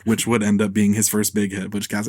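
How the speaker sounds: ordinary delivery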